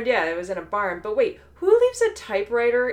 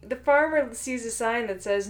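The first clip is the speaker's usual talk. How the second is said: ordinary talk, nothing marked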